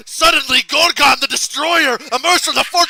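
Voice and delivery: high, booming over-dramatic storytelling; Joel narrating. Retold or imitated. Imitating Joel seizing the improvised story, melodramatic shouting. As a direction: proclaim it at full shout. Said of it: "Joel has to finish the story".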